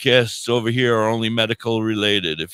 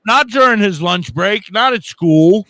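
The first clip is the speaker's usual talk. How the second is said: louder, higher protest; mock outrage; 6 semitones higher, 7 dB louder than usual. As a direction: protest it loudly, half-joking.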